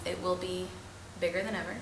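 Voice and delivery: ordinary delivery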